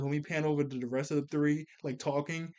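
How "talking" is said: ordinary delivery, nothing marked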